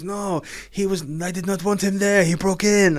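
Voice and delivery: high, loud put-on voice of the burglar; burglar's excuse. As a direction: mimic the burglar pleading innocence, pitched high. Retelling the burglar's words.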